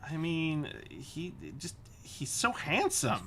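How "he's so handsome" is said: high, drawn-out fangirl gush; swooning. Real, not acted. Fan gushing over a favorite game character, swooning. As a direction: stretch it out in smitten admiration.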